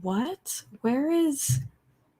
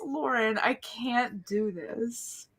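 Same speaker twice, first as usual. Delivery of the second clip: much softer, slow, broken; tearful murmur; 11 dB quieter than usual. Real, not acted. Fan to her friend after the outburst, tearful and soft. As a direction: fade into tears.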